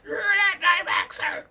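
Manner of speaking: very high, loud squeaky mockery of a hater; squeaky sneering hater impression; mocking hater voice. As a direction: shriek it like a shrill caricature of an online critic. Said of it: "they're probably gonna give me a lot of hate".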